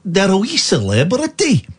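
put-on Scottish voice, mocking; Scottish-accented mockery of a self-important comedian. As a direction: sneer it in an imitation Scottish accent. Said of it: "Don't do the voice".

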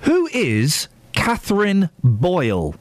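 slow, careful words for Siri; repeating to voice assistant. Real, not acted. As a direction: spell it out for the phone. Speaking clearly to Siri.

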